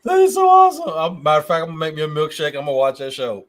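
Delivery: loud, high, laughing enthusiasm; excited. Real, not acted. Friend gets excited about the dating show, loud and high. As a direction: burst out with eager delight.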